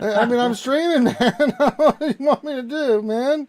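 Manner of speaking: loud, high, exasperated laughing plea; laughing protest. Real, not acted. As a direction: protest helplessly through laughter. Protesting while juggling raid and stream.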